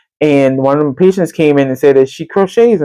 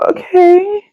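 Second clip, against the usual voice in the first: pitched-up, drawn "Okay"; high compliant okay. Retold or imitated. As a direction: agree sweetly and high. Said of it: "I said".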